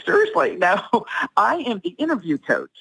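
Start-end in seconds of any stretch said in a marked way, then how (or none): 0.0-0.6 mock disbelief, pitched up high; incredulous. Listener doubting the speaker's pageant work, mock disbelief. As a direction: play the doubter who can't believe it. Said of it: "you're like".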